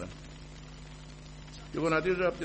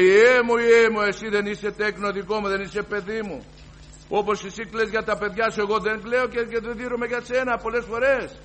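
loud, high booming divine voice; thundering voice of God; 8 semitones higher, 9 dB louder than usual. Retold or imitated. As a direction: boom it out as God speaking. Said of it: "τη στεντόρια φωνή του πατέρα, ανδρική φωνή". (στεντόρια φωνή του Θεού, πολύ δυνατά)

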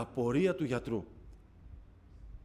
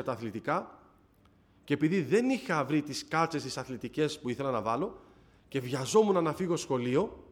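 usual speaking voice

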